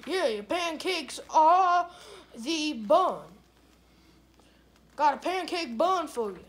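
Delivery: ordinary talk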